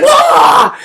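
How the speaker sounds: high mock wail; short put-on shriek; burning scream. Girl burning in his arms, high mock scream.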